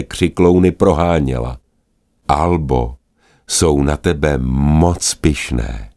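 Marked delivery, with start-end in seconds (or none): none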